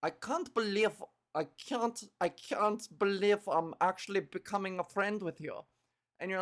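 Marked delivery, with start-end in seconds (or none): 0.0-1.0 put-on Russian accent. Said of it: "she's, like, Russian". 1.3-5.7 heavy put-on Russian accent; brooding loner with a Russian accent; Magic. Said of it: "she's, like, Russian".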